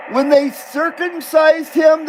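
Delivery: ordinary talk, nothing marked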